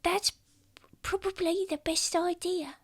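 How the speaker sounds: tiny high hushed voice; adorable child whisper. Acted. Child approving the blackmail plan, adorable high whisper. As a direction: whisper sweetly, high and small. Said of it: "your voice is adorable".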